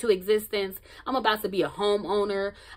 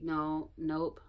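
self-soothing; 3 semitones lower, 8 dB quieter, slower than usual. Retold or imitated. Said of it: "pulling myself in, like".

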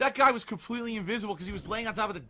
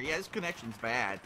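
usual speaking voice

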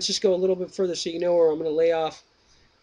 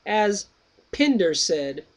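slow, higher, deliberate reading voice; reading Plato's Meno aloud, slow and raised; slow dialogue reading; 5 semitones higher, 4 dB louder, much slower than usual. Acted. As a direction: read the old text out slowly and carefully.

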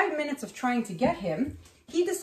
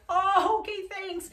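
acted tearful thanks; 10 semitones higher, slower than usual. Dog owner thanks a driver pointing the way, flustered. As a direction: re-enact a flustered thank-you, pitched way up. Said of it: "And I'm like".

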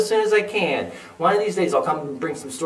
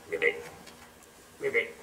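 short, very low ribbit; low little frog noises; frog croak; 14 semitones lower, 12 dB quieter than usual. Acted. Imitates the frog's croak.

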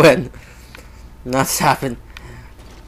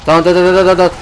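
rapid, louder repeated don'ts; panicked pleading; 4 semitones higher, 7 dB louder, faster than usual. Real, not acted. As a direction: beg the game not to let it happen. Panicked plea while getting hit.

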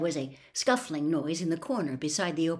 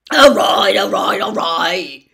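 a huge, furious bellow, far louder; 14 dB louder than usual. Acted. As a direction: roar it out in anger. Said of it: "bellowed Gaunt".

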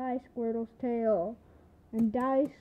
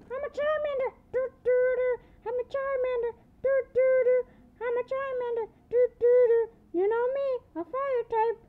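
much higher squeaky Charmander singing doo doo doo; 11 semitones higher than usual. Pretend. Charmander toy introduces itself in a high squeak. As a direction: pitch way up for the little fire lizard.

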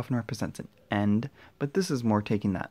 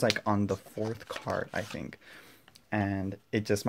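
ordinary talk

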